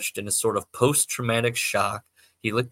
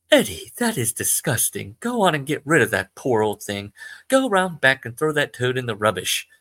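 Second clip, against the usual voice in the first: disgusted British mum, louder; British mother; 3 semitones higher, 5 dB louder than usual. Acted. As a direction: scold him in a British accent. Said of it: "I don't know why she's British".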